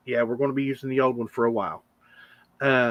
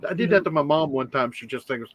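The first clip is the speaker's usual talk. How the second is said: ordinary delivery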